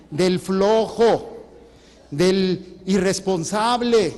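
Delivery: forceful preaching. Acted. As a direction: preach the list with rising force. Preacher listing sinners forcefully. (predicando con fuerza, lento y marcado)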